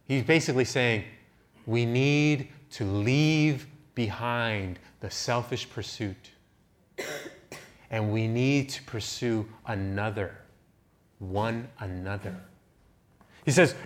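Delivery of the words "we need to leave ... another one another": slow and soft. Real, not acted.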